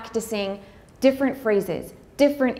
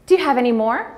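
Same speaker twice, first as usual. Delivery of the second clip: higher, louder innocent question with a rise; lightly voiced nosy lady; chatty stranger; 4 semitones higher, 6 dB louder, faster than usual. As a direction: ask it brightly, unaware of the pain. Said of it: "wants to talk".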